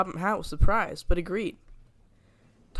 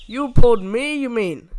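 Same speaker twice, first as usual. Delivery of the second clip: loud, higher indignant retort; arguing; 5 semitones higher, 11 dB louder than usual. A narrator's reading. Douglas arguing with his twin Donald. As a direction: argue it right back. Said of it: "argued Douglas".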